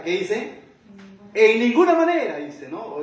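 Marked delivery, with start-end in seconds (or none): 1.3-2.4 much higher and louder, emphatic no; preacher's ringing refusal; categorical refusal. Retold or imitated. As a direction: slam down a firm never. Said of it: "esta palabra categórica".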